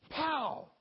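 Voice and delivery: loud, high burst; booming sound-effect word; explosive pow. Acted. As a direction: shout it like a comic-book impact.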